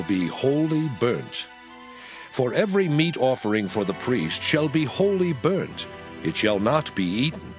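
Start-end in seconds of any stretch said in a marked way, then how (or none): none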